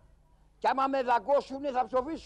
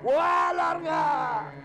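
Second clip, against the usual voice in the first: scared shout; 3 semitones higher, 4 dB louder, slower than usual. Acted. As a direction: yell it out while running for your life. Scared shout, fleeing the beast. (τρομαγμένη κραυγή, δυνατή, ψηλή και αργή)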